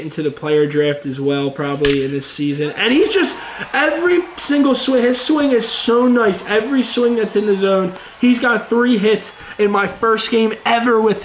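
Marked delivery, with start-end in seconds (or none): 2.8-8.7 higher, eager praise; excited. Real, not acted. Gamer raving to viewers about his new prospect's swing, excited.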